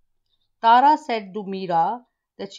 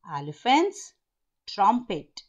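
ordinary talk, nothing marked

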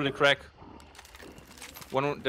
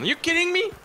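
high, incredulous squeal; disbelieving shout; faster than usual. Real, not acted. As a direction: shriek it in disbelief. Squad game, sudden frustration.

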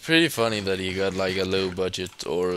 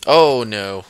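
dismayed cry; 10 dB louder than usual. Real, not acted. Sudden danger in the game.

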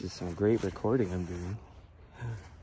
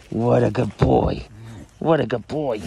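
excited praise for a dog; 11 dB louder than usual. Real, not acted.

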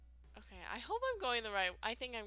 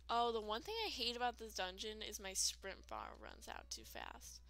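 ordinary talk

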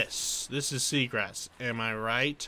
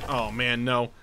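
ordinary talk, nothing marked

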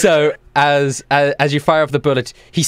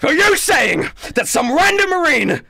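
outraged shout; 10 semitones higher than usual. Acted. Commander outraged that a private ate the fruit, shouting. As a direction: explode in disbelief.

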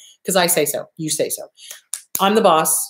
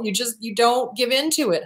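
ordinary talk

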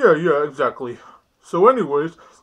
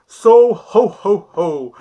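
Santa ho ho ho; 3 semitones higher, 10 dB louder, much slower than usual. Acted. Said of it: "I am Santa".

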